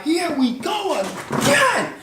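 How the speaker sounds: high, slow, exasperated mock chorus; exasperated guests. Dinner guests groaning at [name], high exasperated mock voice. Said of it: "they would be like".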